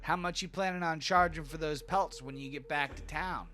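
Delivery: high, probing gnome voice; haggling gnome. Acted. Gnome asks giant the price of pelts, angling. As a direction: fish for a price as a nosy gnome.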